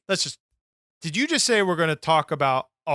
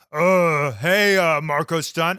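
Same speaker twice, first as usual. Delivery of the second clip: lightly voiced wrestler trash talk; a hint of Big Show; Big Show impression; 4 semitones higher than usual. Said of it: "I'm gonna be Big Show".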